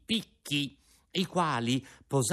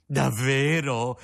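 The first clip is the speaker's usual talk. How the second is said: slow, louder cry of delight; joyful cry; 5 dB louder, slower than usual. A narrator's reading. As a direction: leap for joy on the word. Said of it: "gridò Pinocchio saltando dall'allegrezza".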